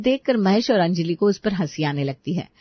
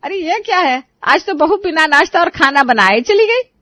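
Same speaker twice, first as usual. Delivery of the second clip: high-pitched outraged exclamation, getting louder; indignant shock; 7 semitones higher, 4 dB louder than usual. Acted. Mother-in-law finds no breakfast made, indignant. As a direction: react aghast at the news.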